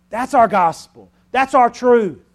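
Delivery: loud, high, ringing sermon voice; full-force preaching. Acted. Preacher proclaiming the cross to his congregation, full-force preaching.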